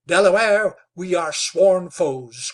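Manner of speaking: loud, slow declaration; a hunter crying out to the chief; cried out. A narrator's reading. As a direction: hurl it at his enemy. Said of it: "cried Wetzel".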